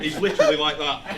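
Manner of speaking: ordinary delivery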